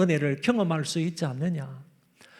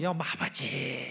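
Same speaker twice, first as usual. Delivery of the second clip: soft, slow, yearning call of Father; slow tender plea to Father; longing; 5 semitones lower, 9 dB quieter, much slower than usual. Shown as a demonstration. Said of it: "좀 사모하는 마음으로".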